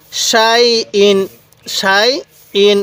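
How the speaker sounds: সাই ইন read very slowly, both syllables drawn out; slow drawn-out recitation. Shown as a demonstration. Teacher reciting সাই ইন to students, slowly drawn out. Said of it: "এরকমভাবে শিখব".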